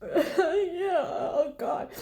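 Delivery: high nervous laugh breaking into a whimper; nervous laughing dread. Retold or imitated. Granddaughter forcing agreement over the casket, laughing nervously and whimpering. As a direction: fake a yes while horrified.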